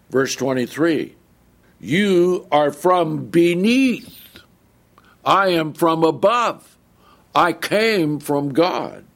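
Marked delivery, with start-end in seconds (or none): 1.8-9.0 slow and forceful, each phrase weighted; emphatic. Acted. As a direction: declare it firmly, slowly. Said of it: "notice how Jesus answered back".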